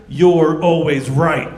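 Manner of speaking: slow, drawn-out "you're always right"; slow tease. Retold or imitated. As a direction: stretch each word to flatter her. Buttering up the girlfriend.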